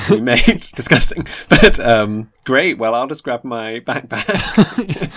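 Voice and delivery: loud, slow mock-appalled groan of a reply; loud mock disgust. Friend mocking the laddish invite, loudly appalled. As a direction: ham up being appalled, loudly.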